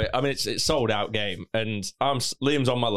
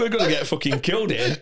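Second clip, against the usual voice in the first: ordinary delivery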